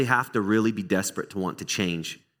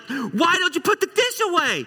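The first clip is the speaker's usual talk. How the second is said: high, louder exasperated parent yell; angry parent; 4 dB louder than usual. Retold or imitated. Parent yelling at the kids about the dish, exasperated high yell. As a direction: blow up over the dish left out. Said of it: "you get so angry".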